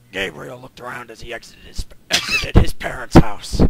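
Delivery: rough, raspy narrator voice. Acted. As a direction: rasp through the reading. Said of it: "why are you doing that sort of voice".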